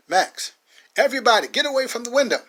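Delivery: high, loud call to get back; urgent warning. Acted. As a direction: order everyone away in alarm. Warning during the attack.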